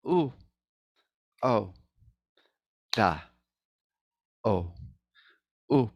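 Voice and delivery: very slow, evenly spaced vowel drill; one syllable at a time with long gaps. Shown as a demonstration. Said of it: "go as slow as you need".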